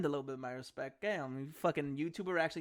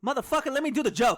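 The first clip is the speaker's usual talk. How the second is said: higher and louder protest; annoyed outburst; 8 semitones higher, 8 dB louder than usual. Real, not acted. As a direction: cut in loudly.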